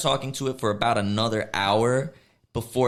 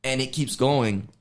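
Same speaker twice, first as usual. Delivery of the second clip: ordinary talk, nothing marked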